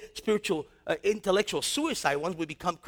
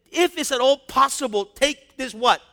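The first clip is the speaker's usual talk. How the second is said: louder, slowing, emphatic scripture; full-force preaching; 6 dB louder than usual. Acted. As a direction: drive home Jesus' words in the garden.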